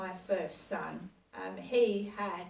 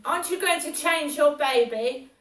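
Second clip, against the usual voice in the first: louder, higher scolding question; 5 semitones higher, 10 dB louder than usual. Retold or imitated. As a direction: tell her off loudly. Officious woman scolding her.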